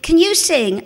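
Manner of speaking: high, loud demand; imitated audition panel voice; woman auditioner. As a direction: fire the question from the stalls. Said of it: "And this voice".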